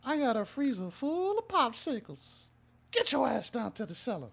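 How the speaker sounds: put-on very high, quavering old pervert voice; a thin, high old-man croon, nothing like his own; Herbert impression. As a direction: creep it out like a leering old man. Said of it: "I'm gonna do a voice for y'all".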